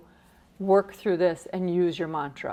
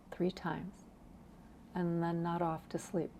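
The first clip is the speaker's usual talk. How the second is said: sleepy hush; 2 semitones lower, 15 dB quieter than usual. Shown as a demonstration. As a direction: lull them towards sleep. Acts out drifting off to sleep.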